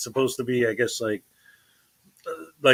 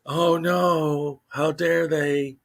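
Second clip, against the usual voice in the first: mock horror; 3 semitones higher, much slower than usual. Host ridicules outrage at cops protecting a child, mock horror. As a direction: feign being scandalized, dragging the words.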